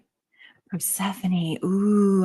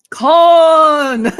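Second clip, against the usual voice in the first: "Khan!" yelled much louder and higher, held long and falling; Khan shout; 7 semitones higher, 11 dB louder than usual. Retold or imitated. Imitating the Star Trek scene, long loud "Khan!" yell. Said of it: "has to be said with emphasis completely".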